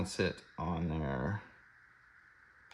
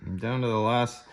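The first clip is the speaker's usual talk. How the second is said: usual speaking voice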